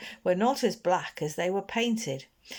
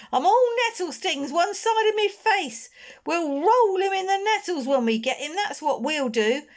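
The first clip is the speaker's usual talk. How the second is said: loud, much higher angry yell; eldest Snarget's threat; 13 semitones higher, 6 dB louder than usual. Acted. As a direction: threaten the boy hiding nearby. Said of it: "He sounded as if he meant it".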